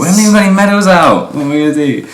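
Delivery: high, fast dismayed outburst; exasperated disbelief. Real, not acted. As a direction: throw up your hands in dismay. Dismay at opponent's medal.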